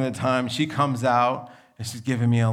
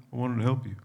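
resigned letdown; much faster than usual. Retold or imitated. Resigned after daughter declines.

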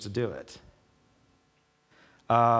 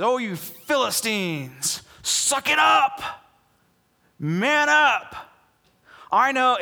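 high, loud battle-cry voice of a commander; rallying war leader; 10 semitones higher, 4 dB louder, slower than usual. Acted. Philistine leader rallying his frightened army, loud high battle cry. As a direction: whip the scared troops into shape.

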